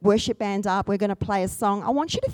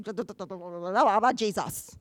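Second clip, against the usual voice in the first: rattled-off blah-blah prayer; mock prayer babble. Imitates quickly muttered prayer.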